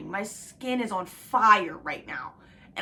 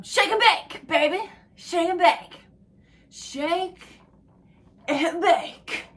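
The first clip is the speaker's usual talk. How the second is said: higher, louder movie catchphrase shout; Talladega Nights quote; 8 semitones higher, 6 dB louder, slower than usual. Retold or imitated. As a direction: bellow it like the movie. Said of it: "What's that by Talladega Nights?".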